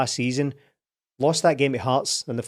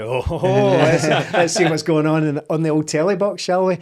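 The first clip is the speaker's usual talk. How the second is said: louder, laughing and gleeful; gleeful mock excitement; 3 semitones higher, 5 dB louder than usual. Fan rushing to the telly with laughing glee. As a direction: relish it like good news arriving. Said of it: "I was like".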